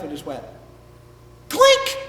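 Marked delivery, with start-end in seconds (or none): 1.5-2.0 high, sharp clink; sudden squeaked snip; knife-snip sound effect. Acted. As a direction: pipe a quick metallic ping. Knife cuts the rope.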